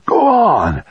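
high, loud excited cry; excited urging. A narrator's reading. Grandfather urging his grandson out the door, excited high cry. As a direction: urge him on with a sudden high cry. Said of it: "Al urged, excited".

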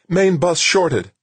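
high, loud, slow alarm; alarmed report. A narrator's reading. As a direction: call out the failure in alarm. Crisis, equipment failing.

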